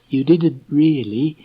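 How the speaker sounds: ordinary delivery, nothing marked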